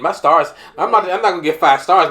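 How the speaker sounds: loud, fast mock panic. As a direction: rattle it off in comic panic. Panicking about Uber rating.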